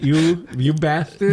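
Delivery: put-on slow Boston-accented "bastard". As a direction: drop the r, Boston style. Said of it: "make it with a Boston accent".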